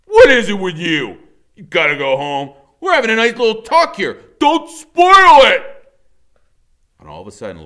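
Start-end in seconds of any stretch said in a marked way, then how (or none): 0.0-5.9 menacing thug. A narrator's reading. Iggy threatening the boys, loud and menacing.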